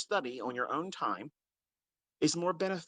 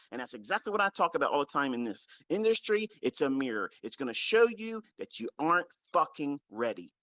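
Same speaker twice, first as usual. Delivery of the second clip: ordinary delivery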